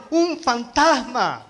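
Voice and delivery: acted cry of alarm, slow and high; retold frightened cry. Disciples seeing him on the water, retold frightened cry.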